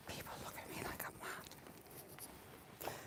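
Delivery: hushed whisper. Real, not acted. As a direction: whisper so passers-by won't hear. Whispers among people nearby.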